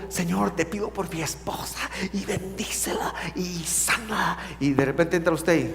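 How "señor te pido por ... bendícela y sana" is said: hushed, unvoiced prayer; husband praying quietly for his wife, whispered. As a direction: pray under your breath.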